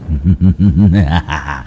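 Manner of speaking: low, drawn-out spectral chuckle; eerie ghost laugh. Acted. Ghost outside the gate laughs at the family, low and eerie.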